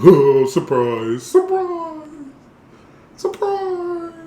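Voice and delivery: high teasing singsong; singsong surprise. Real, not acted. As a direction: tease the reveal sweetly. Teasing reveal of commercial.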